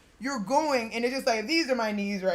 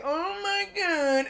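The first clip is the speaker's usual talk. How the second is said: stunned; 8 semitones higher, much slower than usual. Retold or imitated. Retold reaction to being stuck.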